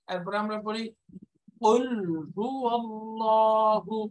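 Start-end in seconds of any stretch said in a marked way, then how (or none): 1.6-4.0 Quran recitation. Real, not acted. Teacher recites verse slowly.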